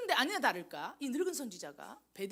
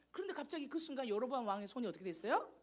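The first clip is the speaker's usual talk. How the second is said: ordinary talk, nothing marked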